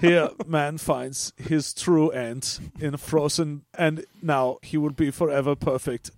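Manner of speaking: mock-solemn nature documentary narrator; Werner Herzog. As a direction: intone it gravely with a German accent. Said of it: "Werner Herzog comes on and narrates".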